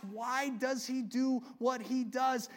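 ordinary delivery

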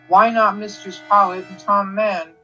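ordinary delivery

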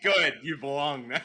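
cackling welcome; laughing evil glee. Acted. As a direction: laugh while saying it. Laughing at friend's sadness.

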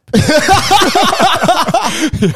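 long, high, loud burst of laughter. Real, not acted. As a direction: crack up at your own story.

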